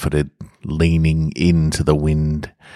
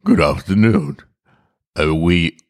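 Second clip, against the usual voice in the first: a hint of gruff disguise; lightly roughened bluff greeting; gruff man trying to sound normal. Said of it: "He said in a gruff voice".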